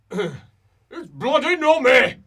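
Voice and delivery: loud, high, drawn-out character bellow; angry shout. Acted. Angry character heard from far off, bellowing in disgust.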